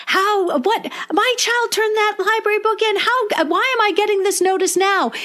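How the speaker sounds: mock outraged parent, pitched high; imitating upset parent. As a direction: play the indignant parent, voice up high. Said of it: "upset email from a parent".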